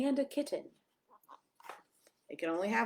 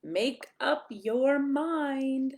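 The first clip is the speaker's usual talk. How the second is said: slow, deliberate; 5 semitones higher, 6 dB louder, much slower than usual. A narrator's reading. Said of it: "pick a pet fast".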